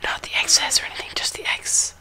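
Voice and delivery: hushed phone show and tell; whispering. Real, not acted.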